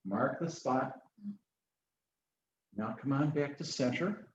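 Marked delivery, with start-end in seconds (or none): none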